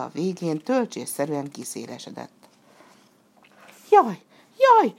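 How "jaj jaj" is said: high, loud squeal of fright. A narrator's reading. Burattino squeals in fright as robbers appear, high and loud. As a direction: squeak it out in sudden terror. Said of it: "sipította Burattino".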